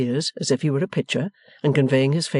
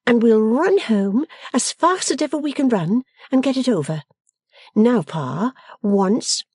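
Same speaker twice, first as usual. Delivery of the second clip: higher-pitched, lively daughter voice; playful Bella; 10 semitones higher than usual. A narrator's reading. Bella urging Pa to run home, playfully bossy. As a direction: chivvy along a reluctant father, pitched up.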